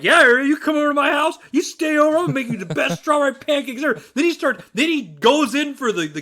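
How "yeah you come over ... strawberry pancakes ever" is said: put-on higher, insistent drunken boasting; worked-up, getting mad. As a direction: retell a heated, hurried outburst. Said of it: "and I'm getting mad".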